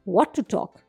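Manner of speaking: ordinary delivery, nothing marked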